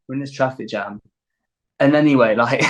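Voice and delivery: plain reading voice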